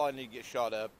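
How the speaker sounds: talking through hiccups; hiccuping between words. Real, not acted. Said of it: "Excuse me, got the hiccups".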